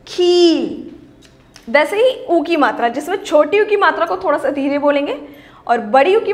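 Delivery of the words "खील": खील with a long, firm ee; खील, लंबी ई ज़ोर से; long ee, stressed. Shown as a demonstration. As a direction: stretch the ee in खील. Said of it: "उसको थोड़ा सा ज़ोर की बोलेंगे".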